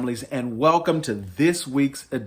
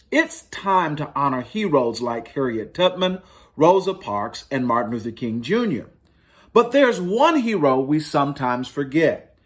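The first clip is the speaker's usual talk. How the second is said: lightly lifted storybook read-aloud; reading to children; 4 semitones higher than usual. Acted. Librarian reading to families, mild storytelling voice. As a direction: tell it gently like a picture book. Reads picture book aloud.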